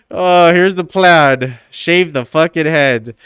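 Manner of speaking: ordinary delivery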